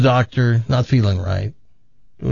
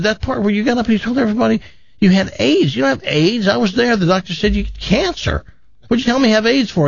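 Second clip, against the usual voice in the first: high, quick incredulous questioning; baffled protest; 9 semitones higher, faster than usual. Acted. Son confronting his dad after everyone left, high baffled protest. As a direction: demand an explanation, pitched up and bewildered.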